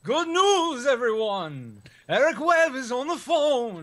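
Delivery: put-on crank up the cranky old scientist; Professor Farnsworth impression.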